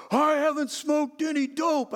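high, loud, slow whining protest; whiny teen denial. Retold or imitated. Son whining to his dad in the car, slow and loud. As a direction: drag it out like a sulking teenager.

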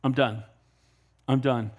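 voice of the Lord, final. Retold or imitated. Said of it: "Now the Lord says".

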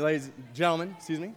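usual speaking voice

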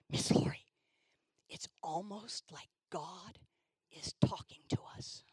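slow, hushed whisper of wonder; whispering young girl amazed; awed whisper. Retold or imitated. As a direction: breathe it in awe. Awed girl realizing God speaks.

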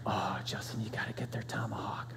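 reverent whisper, low and soft. Retold or imitated. Friend whispering his top steak tip to Justin, reverent whisper. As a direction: breathe it like a secret treasure. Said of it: "he speaks in a whisper".

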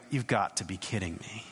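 a quiet, fed-up aside; eye-rolling disbelief. Retold or imitated. Isaiah rolling his eyes at the king's excuse, quietly exasperated. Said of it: "just rolling his eyes going".

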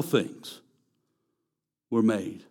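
preacher softly echoing his own proclamation. Shown as a demonstration. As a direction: let "All things were made" drop away quietly. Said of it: "quiet echo of repeated line".

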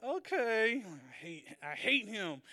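grudging okay through a fake smile; forced smile. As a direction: hide the hatred behind a grin. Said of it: "doing, like, that, that smile".

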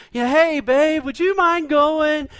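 butter her up like a dad wanting help; sweet-talking dad. Retold or imitated. Pastor imitating a dad sweet-talking his daughter, coaxing. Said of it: "a dad or a grandpa or somebody said".

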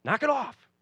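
sudden high snapping shout; lost-temper parent. Pretend. Parent losing patience with spazzing kids, yelling sharply. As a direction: bark it at the kids like you've lost it. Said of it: "maybe you get crazy and you tell 'em".

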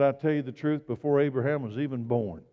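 ordinary delivery

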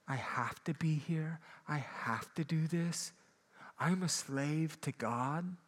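slow, whispered, put-upon grumble; grudging duty voice. Retold or imitated. A reluctant believer approaching the Bible, slow grudging grumble. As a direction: drag through it like a chore, sighing. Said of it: "with that same mentality".

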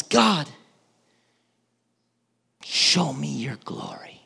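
breathy hushed whisper to God; awed whispered prayer; whispered plea. Retold or imitated. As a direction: ask it in a reverent whisper.

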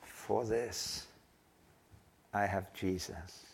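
slow, soft, slurred words after a stroke; very slowly. Retold or imitated. Old man after a stroke on the phone, slow and slurred. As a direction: let each word drag out quietly, barely formed. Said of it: "He said very slowly".

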